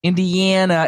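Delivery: INDIANA high, with a drawn-out "an"; teasing echo of "Indiana" with a long A; mimicked regional vowel.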